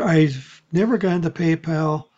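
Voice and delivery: usual speaking voice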